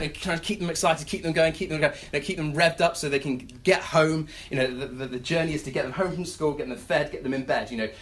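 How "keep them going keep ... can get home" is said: ordinary talk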